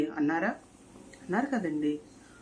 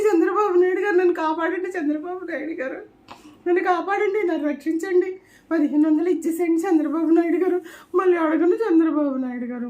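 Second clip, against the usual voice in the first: much higher and louder, a hammed-up crying plea for help; mock terrified screaming; 10 semitones higher, 8 dB louder than usual. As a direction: wail for rescue like in a nightmare, half joking. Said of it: "భయపడి అరుస్తున్నావు". (భయంతో అరుస్తూ నటించిన వేడుకోలు)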